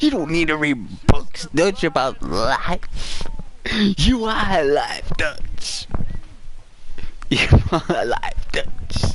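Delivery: the sidekick worshipping his boss; hammed-up devotion; Mike voice. Mike flattering Dutch.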